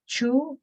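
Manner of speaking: 去 pitched high as a tone demonstration; fourth tone. Shown as a demonstration. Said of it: "it has a fourth tone".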